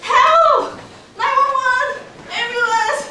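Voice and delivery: high, loud cries for help; panicked shouting. Retold or imitated. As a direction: yell for help at a fire. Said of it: "And I shouted".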